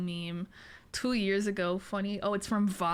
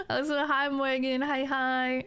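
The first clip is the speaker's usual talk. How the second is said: usual speaking voice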